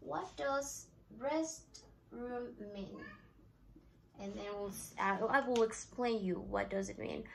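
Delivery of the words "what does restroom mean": slow, careful teacher question; slow model. Shown as a demonstration. Teacher modelling the question slowly for learners. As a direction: lay out each word clearly. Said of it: "using the same example".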